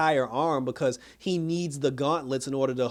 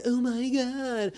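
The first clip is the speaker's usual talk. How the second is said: drawn-out, high shocked gasp; mock gasp; 8 semitones higher, 4 dB quieter, much slower than usual. Chrissy gasping in shock at Lois. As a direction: gasp it out slowly like a scandalized friend. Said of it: "Chrissy was all like".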